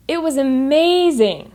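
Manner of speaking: "amazing" swelling up high before it drops; rising intonation on amazing. Shown as a demonstration. Teacher showing how amazing it was, intonation rising on "amazing". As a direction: lift the pitch into "amazing". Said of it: "There's a rise in my intonation".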